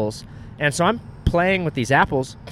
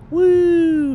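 high drawn-out "woo"; imitated obligatory whoop; sing-song "woo" for the camera; weak mock cheer; 14 semitones higher, 6 dB louder than usual. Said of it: "And then I was like".

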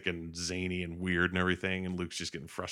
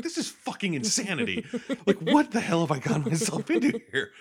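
high, rising mock-exasperated rant; exasperated outburst; 12 semitones higher, 5 dB louder than usual. Luke watching the creature smack R two, exasperated. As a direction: build to a loud, baffled outburst.

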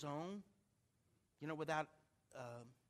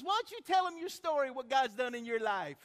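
high, loud, insistent retelling; loud urging; 8 semitones higher, 10 dB louder than usual. Retold or imitated. Girl urges pastor to tell his story, loudly. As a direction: voice her urging him in front of everyone.

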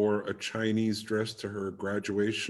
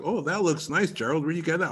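imitated admirer; 7 semitones higher than usual. Voices an admirer back home.

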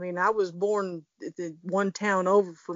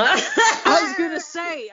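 laughing; 9 semitones higher, 9 dB louder, slower than usual. Real, not acted. Laughing in disbelief.

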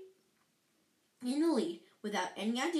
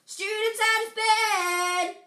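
high, slow, drawn-out bellow; 11 semitones higher, 10 dB louder, slower than usual. Acted. Poltergeist alerting Filch to students, bellowed. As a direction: holler it like a town alarm. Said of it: "Peeves bellowed".

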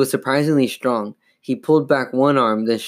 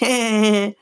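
high-pitched dummy cackle; rapid, high "heh-heh"; evil laugh; 10 semitones higher than usual. A narrator's reading. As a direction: laugh like a wicked puppet. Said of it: "The dummy laughed".

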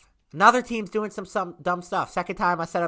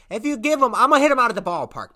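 fast, loud and higher; excited; 7 semitones higher, 8 dB louder, faster than usual. Acted. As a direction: rush it out all pumped up. Hyped about easy gimmes.

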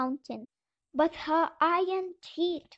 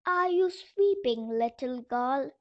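usual speaking voice

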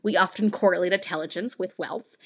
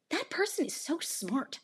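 high, slow, softer gushing; admiring listener; 8 semitones higher, 7 dB quieter, slower than usual. Pretend. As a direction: play someone swooning over an accent. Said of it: "hear a British accent and think".

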